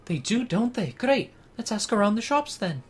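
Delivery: high, upbeat character voice; game character voice. Acted. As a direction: agree eagerly, pitched well up. Reading game character's dialogue.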